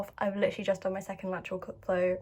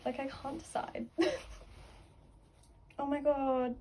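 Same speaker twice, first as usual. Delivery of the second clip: ordinary talk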